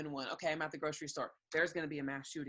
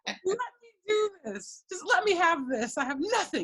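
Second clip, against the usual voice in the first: mock pleading; 13 semitones higher, 10 dB louder than usual. Mother mock-begging her child to let her play, laughing whine. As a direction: beg like it's all you have left.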